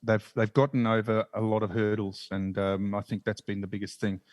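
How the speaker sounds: ordinary talk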